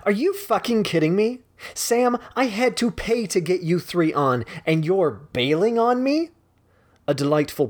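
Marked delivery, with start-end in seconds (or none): 0.1-6.3 higher, outraged phone rant; furious on phone. Acted. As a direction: blast her client for bailing. Gretchen yells on phone.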